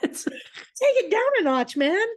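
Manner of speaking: high, laughing "take it down a notch"; laughing plea. Real, not acted. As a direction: laugh while telling him to calm down. Laughing at dad's outburst.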